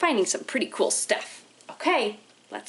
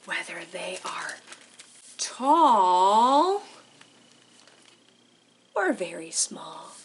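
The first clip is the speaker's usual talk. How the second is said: playful whisper; 4 semitones lower, slower than usual. Acted. Playful whisper in read-aloud.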